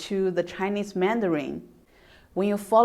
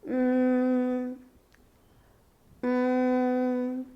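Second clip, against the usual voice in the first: long hum on one steady note; humming; 3 semitones higher than usual. Shown as a demonstration. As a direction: hum "mm" evenly, without moving the pitch. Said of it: "like humming something".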